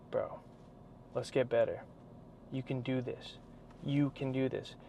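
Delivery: quiet supportive encouragement; soft encouragement. Retold or imitated. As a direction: murmur it softly as support. Quoting supportive people softly.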